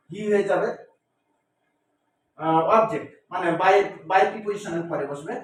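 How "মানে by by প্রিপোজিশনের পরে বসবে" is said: ordinary delivery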